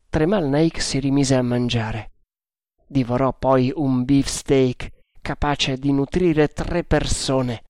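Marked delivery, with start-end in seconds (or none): none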